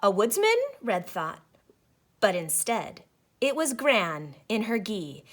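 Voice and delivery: ordinary talk, nothing marked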